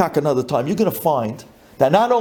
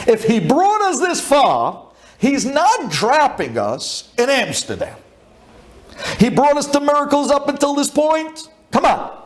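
high, impassioned pep talk; rally them insistently, pitched up; fervent reassurance; 9 semitones higher than usual. Retold or imitated. Leader to panicking companions about trusting Hashem, fervent.

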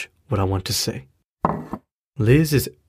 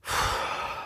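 weary breath out; soft tired exhale; heavy sigh; 11 dB quieter than usual. Acted. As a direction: sigh as if the weight is too much. Father pausing mid-confession.

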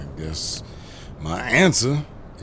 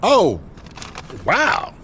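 thrilled the stranger can take the photos; relieved delight; 13 semitones higher than usual. Acted. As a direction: gush with relief. Relief at finding FBI help.

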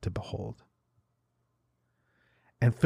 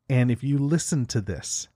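ordinary talk, nothing marked

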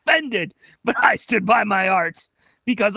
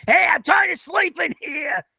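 grumpy chicken; 7 semitones higher than usual. Retold or imitated. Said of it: "maybe there's a chicken inside, like".

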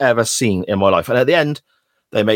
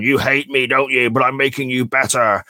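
higher, hammed-up cheesy shouting impression; mock a horribly cheesy motivator; cheesy drill sergeant; 3 semitones higher than usual. Drill sergeant shouting at his trainee, hammed-up and cheesy. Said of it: "in a really horrific, cheesy way".

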